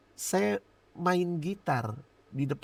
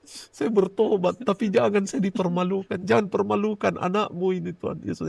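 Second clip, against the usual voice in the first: higher, louder begging to God; retold pleading prayer; 4 semitones higher, 4 dB louder than usual. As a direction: beg not to be exposed. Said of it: "langsung berdoa sama Tuhan".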